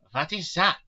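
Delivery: high, loud hoarse question; hoarse Van Helsing. A narrator's reading. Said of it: "asked Van Helsing in a hoarse voice".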